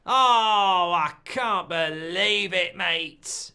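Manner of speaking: long drawn-out cry of disbelief; despairing groan. Real, not acted. Host lamenting a goal to viewers, despairing groan. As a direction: start loud, then sag slowly into dismay.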